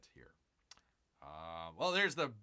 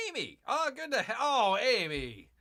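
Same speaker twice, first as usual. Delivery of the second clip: glad greeting; 9 semitones higher, 5 dB louder than usual. Real, not acted. Host spotting a regular viewer, calling out happily.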